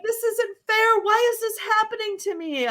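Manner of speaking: high, whiny protest; a hurt inner voice moaning at the unfairness; resentful part complaining. Retold or imitated. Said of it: "or, you know, like".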